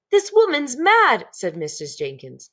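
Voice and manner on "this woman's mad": much higher, louder, slow snooty wife. A narrator's reading. Mrs Jenkins to her husband about the grandmother, high and snooty. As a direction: look down your nose at the mad woman.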